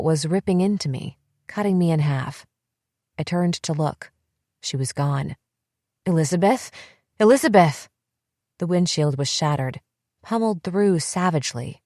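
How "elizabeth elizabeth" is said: calling out louder, rising to a scream; screaming a name. A narrator's reading. Narrator screaming for her sister after the crash.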